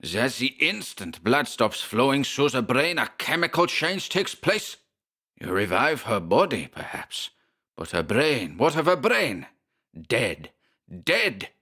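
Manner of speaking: high, raging German professor; furious German rant. Acted. Schmersholt rants about the dead brain, German accent. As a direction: rage it at him in a German accent. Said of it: "he shrieked".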